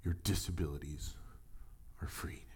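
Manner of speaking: soft words fading into a whisper; hushed, almost whispered. Retold or imitated. Preacher voicing God freeing the woman, hushed to a whisper. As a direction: breathe the last words out. God's quiet word of release.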